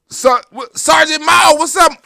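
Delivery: loud, high hail to a viewer in chat; excited shout-out. Real, not acted. Calling out to Sergeant Mo in the chat, loud and high. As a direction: greet him like spotting a friend across the room.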